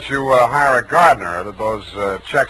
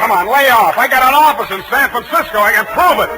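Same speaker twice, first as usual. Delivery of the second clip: much higher, loud panicked protest; desperate protest; 13 semitones higher, 4 dB louder than usual. Acted. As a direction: yell to be let go, voice climbing high. Detective protests while dragged away.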